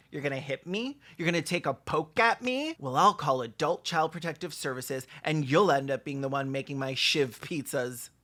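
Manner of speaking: ordinary talk, nothing marked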